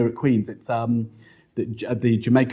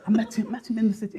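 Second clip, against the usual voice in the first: laughing, high and quick; 10 semitones higher, faster than usual. Real, not acted. Laughing retelling of fear.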